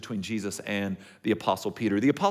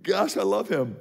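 ordinary delivery

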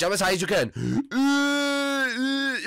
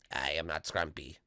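much lower, softer spooky voice; low ghost voice; 13 semitones lower, 12 dB quieter than usual. Pretend. The ghost denying it is Scrumpy, low and soft. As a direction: say it like a creepy ghost.

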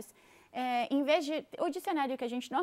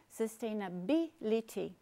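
"Sustainability" slowly, BI stressed; slow down and lean on the "bi" in "Sustainability"; 3 semitones lower, slower than usual. Shown as a demonstration. Teacher models the tonic syllable, slow with BI stressed in "Sustainability". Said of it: "a sílaba tônica é B".

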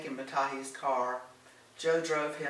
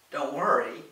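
louder, indignant echo of the reassurance; loud retort; 7 dB louder than usual. A narrator's reading. Lorraine hurls Gordon's reassurance back at him, loud. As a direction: throw the words back loudly. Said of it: "Lorraine responded loudly".

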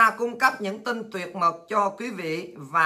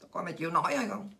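soft, sneering repeated question; quiet mocking taunt; 3 semitones lower, 9 dB quieter, faster than usual. Real, not acted. Needling China quietly, asking if it can endure. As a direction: drop the voice and needle him.

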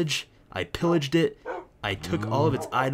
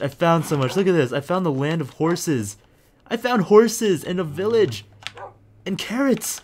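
higher, louder, building giddy brag; excited; 7 semitones higher, 5 dB louder than usual. Real, not acted. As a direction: gush about the finds, climbing higher. Rising excited gaming recap.

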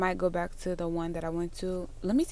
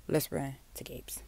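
soft unvoiced whisper; 4 semitones lower, 7 dB quieter than usual. Real, not acted. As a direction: whisper it as a quick aside. Quick aside in a store.

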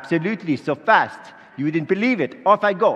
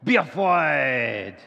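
slow, grand heavenly proclamation; booming angel voice; much slower than usual. Acted. Angel Gabriel greeting the crowd, grand and slow. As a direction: announce yourself like a mighty angel.

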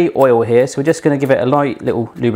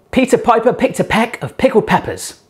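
higher, odd-sounding tongue twister; voice test tongue twister; 7 semitones higher than usual. Real, not acted. Baker testing her failing voice, strained and high. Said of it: "I'm back in the room".

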